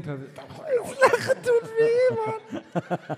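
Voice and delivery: very high, very slow laughing wail; laugh-crying squeak. Acted. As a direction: wail it while cracking up. Angel laughing in pain.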